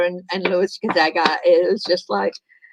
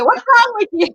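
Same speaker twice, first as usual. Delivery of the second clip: mock scolding; 13 semitones higher, 5 dB louder than usual. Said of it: "Judge Judy".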